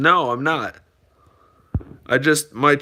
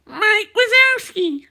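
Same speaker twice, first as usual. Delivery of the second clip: much higher, slow cartoon monster voice; squeaky helium-high Mike Wazowski; slower than usual. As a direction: stretch the name out like Mike from Monsters Inc. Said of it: "Did my voice get really high on helium".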